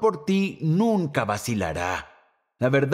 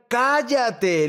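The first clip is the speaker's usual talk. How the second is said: higher, sharp mocking shout of shut up; mock crowd yell; 7 semitones higher than usual. Pastor imitating the crowd silencing Bartimeo, mock yell. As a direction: snap it like a hostile crowd. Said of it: "le están gritando".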